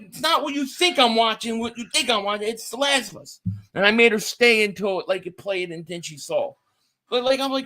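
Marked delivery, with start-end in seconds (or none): 0.0-3.4 flustered defense. Retold or imitated. Defending himself to wife.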